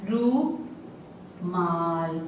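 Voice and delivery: रूमाल with the रू held long and slow. Shown as a demonstration. Teacher to class, stretching रूमाल slowly. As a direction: draw out रू in रूमाल. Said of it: "ज्यादा समय लग रहा है ना".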